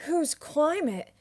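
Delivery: high, slow, loud put-on voice; hammed-up meme quote; meme character voice. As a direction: deliver it like a naive cartoon sage. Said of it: "the climate changing meme reference".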